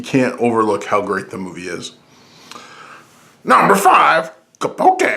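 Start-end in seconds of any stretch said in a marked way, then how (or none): 3.4-5.0 loud, high announcement; countdown announcer. Pretend. Host revealing his fifth pick, loud high announcer call. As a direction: shout the ranking, then pitch the title way up.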